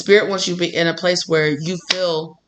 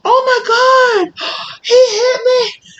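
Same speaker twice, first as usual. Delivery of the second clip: slow, much higher fake sob with gasps; mock crying; 5 dB louder, much slower than usual. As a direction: whimper as if struck, hammed-up. Pretends to be hit by Spirit.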